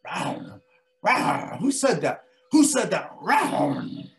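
loud rawring big cat voice; roar, then demand who spoke; growling mountain lion. Acted. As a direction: mountain lion roaring at an unseen voice. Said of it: "Mountain lion goes".

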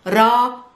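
raq drawn as "raa" with a separate popped q; guttural qaf ending. Shown as a demonstration. Teacher drilling second syllable, raq with guttural final q. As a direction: finish raq on a back-of-throat q. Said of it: "guttural qaf at the ending".

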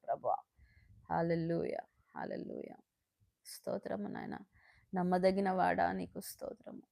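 hushed, trailing thanks; fading whispered praise. Real, not acted. Closing the prayer with whispered thanks to God. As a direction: let the praise fade to a whisper.

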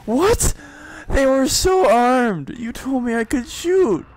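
high, loud, outraged squeal; indignant protest. Acted. Gamer protesting to the game that they were armed, indignant.

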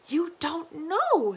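put-on slow, high ominous old woman; dark old granny. Granny warning Lina, slow and darkly. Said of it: "said Granny darkly".

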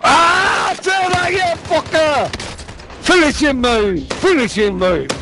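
high frantic yelling; excited shouting. Real, not acted. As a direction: yell it mid-fight. Frantic fight in the game.

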